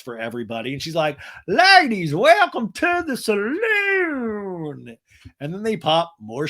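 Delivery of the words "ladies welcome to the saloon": high, loud, very slow Texas drawl; mock Texas accent. Shannon greeting the ladies, in a hammed-up Texas accent. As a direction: stretch it out like a saloon madam. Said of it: "Shannon's Texas accent".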